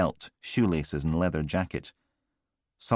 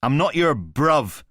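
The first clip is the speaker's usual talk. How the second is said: slow, louder, higher clenched anger; angry; 9 semitones higher, 7 dB louder, slower than usual. A narrator's reading. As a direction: lean hard on not, furious. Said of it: "clenched his good hand into a fist".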